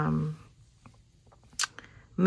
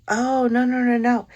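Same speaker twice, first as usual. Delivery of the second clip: surprised protest; 6 semitones higher, 8 dB louder than usual. Real, not acted. Sudden realisation, mistaken location.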